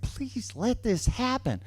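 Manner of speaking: high, small pleading voice. Retold or imitated. Boyfriend gives girlfriend his most pleading eyes, high soft begging. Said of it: "most pleadingest eyes".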